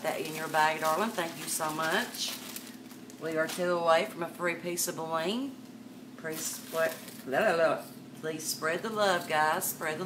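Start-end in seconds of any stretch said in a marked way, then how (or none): none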